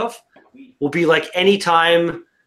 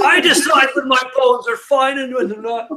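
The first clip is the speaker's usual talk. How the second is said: higher, hammed-up defiance; mock tough film hero; 8 semitones higher than usual. Playing hero who ripped off his cast, mock defiant. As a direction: playfully insist like an action hero.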